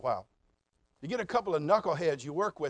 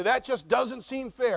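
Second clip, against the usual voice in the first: whiny complaint; 6 semitones higher, 6 dB louder, slower than usual. Retold or imitated.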